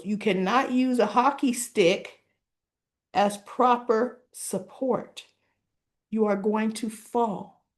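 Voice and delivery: slow, word-by-word lecture; acted stern warning. Nurse warning Derek off the hockey stick, stern. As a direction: lay it down slowly and deliberately.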